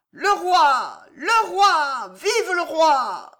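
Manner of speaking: loud, high, drawn-out cheering; crowd cries. A narrator's reading. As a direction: hail the king across a courtyard. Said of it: "Des cris éclataient dans la cour".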